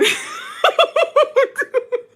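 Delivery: helpless high-pitched laughing fit; high cackling laugh. Real, not acted. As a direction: burst out in a long, high laugh. Laughs at her own memory.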